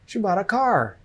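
"bought" and "car" stressed; teacher's model stressing "bought" and "car"; stress on content words. Shown as a demonstration. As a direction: punch "bought" and "car", keeping "She" and "a" light. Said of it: "bought and car".

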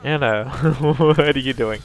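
slow, laughing exasperated question; laughing disbelief. Real, not acted. Teammates misplaying, repeated question.

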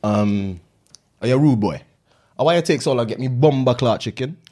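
playful Jamaican patois, lilting and cocky; Jamaican accent. Pretend. Friend asking in mock Jamaican accent why the chicken took so long.

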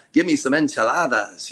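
light mock Mexican accent ordering food; playful hint of a Mexican accent; lightly put-on Mexican accent ordering enchiladas. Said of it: "order it with a Mexican accent".

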